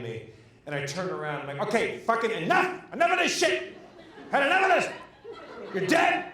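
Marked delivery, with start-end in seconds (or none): 1.7-6.2 high, loud exasperated yelling; shouting down a ghost. Retold or imitated. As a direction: bellow it to banish an unwanted intruder. Retold outburst at a ghost.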